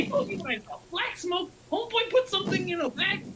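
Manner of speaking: high, tense insistence; agitated insisting. Acted. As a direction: insist you saw it. Character insisting angrily.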